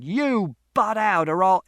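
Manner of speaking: loud, high, slow warning; threatening shout. A narrator's reading. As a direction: shout down the meddler, cut short. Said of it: "Father shouted".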